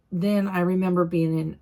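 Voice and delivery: ordinary delivery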